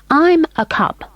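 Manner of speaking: put-on a small boy's polite voice. A narrator's reading. William voiced.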